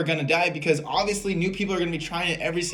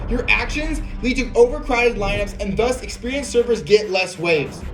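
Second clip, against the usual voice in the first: sarcastic reading. Retold or imitated. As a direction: read it like a smug troll. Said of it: "McFadden Junior, thirty-six, says".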